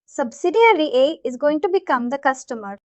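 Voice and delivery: usual speaking voice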